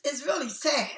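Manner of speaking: normal talking voice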